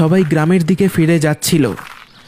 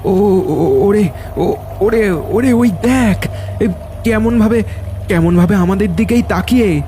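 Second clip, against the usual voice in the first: higher, stuttering fright; frightened, stumbling, higher voice; terrified stammer; 4 semitones higher than usual. Acted. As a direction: stammer as the creature stares back. Fisherman sees creature staring.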